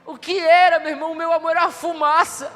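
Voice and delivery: swelling, fervent sermon cry, slowing on the big words; preaching at full force. Acted. Pastor to his congregation about God's love, fervent high preaching cry.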